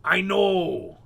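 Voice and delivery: loud gruff pirate cry; hearty pirate exclamation. Acted. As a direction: shout it like a pirate. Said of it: "both cried at once in the same pirate voice".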